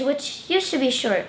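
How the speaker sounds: ordinary delivery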